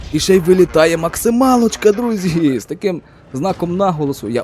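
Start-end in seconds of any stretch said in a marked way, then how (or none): 0.1-2.6 thrilled. Real, not acted. Max damage roll on the hit.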